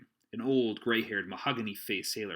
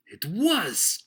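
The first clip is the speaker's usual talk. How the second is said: high, loud excited cry; quite excited; 10 semitones higher, 10 dB louder than usual. A narrator's reading. Silver hears the blind man's name, excited cry. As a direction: burst out in excitement. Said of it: "cried Silver, now quite excited".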